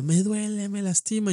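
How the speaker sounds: lightly voiced mom protesting; mother telling son he hurts her; hurting mother.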